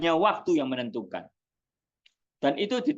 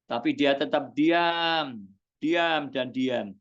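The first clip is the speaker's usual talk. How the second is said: slow emphasis; 3 semitones lower, slower than usual. Acted. Preacher dwells on silence.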